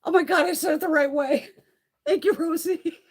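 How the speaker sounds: high, loud and delighted; excited. Real, not acted. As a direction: burst out happily at getting it right. Excited thanks to chat.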